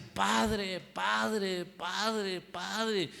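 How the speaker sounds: imitando un clamor repetido, en tono agudo; clamor imitado. Retold or imitated. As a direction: clama una y otra vez, sin gritar del todo. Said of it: "gritándole". (grito recreado, agudo y moderado)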